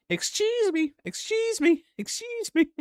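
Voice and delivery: very high squeaky repeated "excuse me"; squeaky Jar Jar voice. Retold or imitated. Vlogger imitating the Jar Jar mouse, high and squeaky. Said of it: "It's like".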